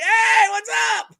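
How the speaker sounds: high, slow called-out hello; greeting friends pulling into their spot; mock greeting shout. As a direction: call across the parking lot. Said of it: "I'm like".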